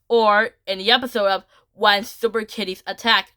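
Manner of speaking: ordinary delivery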